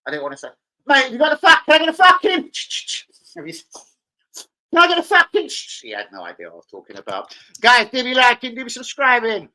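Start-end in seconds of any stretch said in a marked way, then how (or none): 0.9-5.5 loud, high, aggressive shouting; Football Factory scene. Acted. Diner acting out a Football Factory scene, aggressive shout. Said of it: "do a scene out of Football Factory". 7.6-9.5 loud, raised-pitch call-out; mock viewer plea. Streamer begging viewers to subscribe, loud high call.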